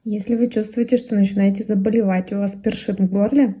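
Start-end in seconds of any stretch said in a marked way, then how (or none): none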